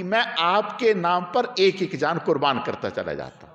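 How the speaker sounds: high, loud, slow impassioned cry; fervent declaration. Acted. Preacher voicing the companion telling the king he wishes for many lives, impassioned. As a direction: pour it out like a devoted man ready to die.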